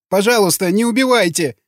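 high-pitched lamenting beg; wailing plea. A narrator's reading. Gunner pleads under interrogation, wailing plea. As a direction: keep begging in a high, frightened voice. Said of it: "продолжал причитать пулемётчик".

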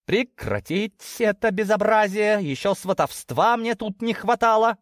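high-pitched, emphatic order; indignant huff. A narrator's reading. Daughter halting the matchmaking, indignant. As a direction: put a stop to it, pitched high. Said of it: "фыркнула овечка".

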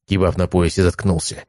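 usual speaking voice

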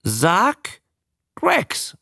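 deliberate prompting; trying to teach. A narrator's reading. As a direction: say each word apart for a pupil to repeat. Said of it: "versuchte er es".

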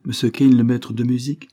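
mildly taken aback; questioning repeat. A narrator's reading. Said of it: "répéta lady Isabelle".

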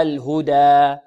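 الهدى said loud with a clean alif; model recitation. Shown as a demonstration. Teacher leading students to repeat الهدى, loud. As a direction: lead with a firm الهدى. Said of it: "انطقوا معي".

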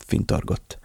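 ordinary delivery, nothing marked

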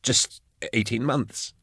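quiet and slow, timid; timid answer. A narrator's reading. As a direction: say it small and careful. Nervous Drusha answering.

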